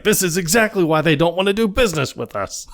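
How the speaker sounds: high, fast exasperation; imitated animated rant. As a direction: rail at them like a worked-up boss. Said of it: "like, really animated".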